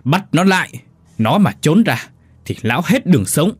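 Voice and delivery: wife roaring to seize her; roared order. A narrator's reading. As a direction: bark the order at her husband. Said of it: "Mụ gầm lên".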